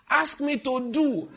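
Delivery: high, loud, slow insistent preaching; full-force preaching. Real, not acted. Pastor voicing God to the church in a loud, rising, insistent cry. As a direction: hammer each repeat harder.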